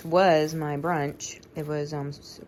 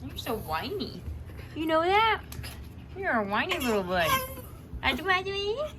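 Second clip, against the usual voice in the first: cooing pet talk; 12 semitones higher than usual. Real, not acted. Cooing to the whining dog.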